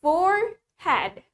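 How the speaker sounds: "Forehead" drawn out very slowly, each syllable clear; slow model pronunciation. Shown as a demonstration. Teacher to pupils, modelling the word: very slow and clear. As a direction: say "Forehead" slowly for the class to copy. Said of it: "Let's try to pronounce it with me".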